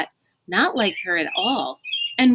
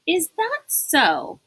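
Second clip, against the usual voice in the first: skeptical frown; 9 semitones higher, slower than usual. A narrator's reading. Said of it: "Jilson frowned".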